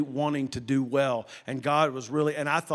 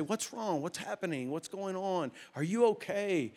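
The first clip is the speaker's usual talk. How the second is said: much softer, gently questioning; retold calming concern; 3 semitones higher, 7 dB quieter than usual. Preacher to a bawling man, soft concerned questions. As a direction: soothe someone who is distraught, as retold.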